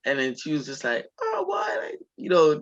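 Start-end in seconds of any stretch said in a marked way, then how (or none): 1.2-1.8 high-pitched mock-rude exclamation. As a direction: imitate a rude mom in a much higher voice. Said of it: "just being mad rude, disrespectful".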